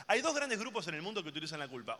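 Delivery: ordinary talk, nothing marked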